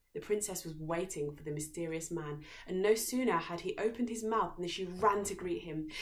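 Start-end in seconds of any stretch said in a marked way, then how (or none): none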